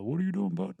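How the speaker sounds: a light Kansas twang on the dad's line; casual Kansas farmer accent, imitated. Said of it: "so I'll do the accent".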